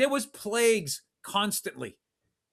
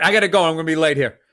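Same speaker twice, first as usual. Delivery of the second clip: ordinary talk, nothing marked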